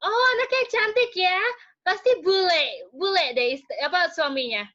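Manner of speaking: high, loud mock grandma gushing; imitating gushing grandmothers. Grandmothers admiring the pale baby, high mock gushing. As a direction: coo over the baby like an old auntie. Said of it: "Oma Oma Indonesia ngeliatin".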